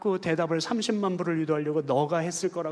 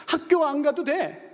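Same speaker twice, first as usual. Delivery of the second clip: laughing approval; 8 semitones higher, slower than usual. Retold or imitated. Father laughing inwardly.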